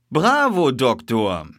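high, loud bravo; delighted praise. A narrator's reading. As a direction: cheer on your companion. Detective praises doctor's find.

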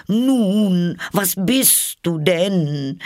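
high, loud, drawn-out bird voice; challenging pigeon. A narrator's reading. Pigeon presses Alice on what she is, challenging. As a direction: demand an answer in a slow squawk.

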